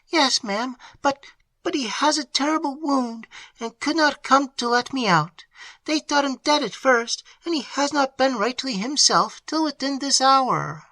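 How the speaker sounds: put-on high, anxious young servant voice; distressed maid. A narrator's reading. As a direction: pour out the bad news anxiously. Annette, maid voiced by reader.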